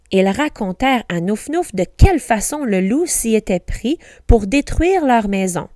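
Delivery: ordinary delivery, nothing marked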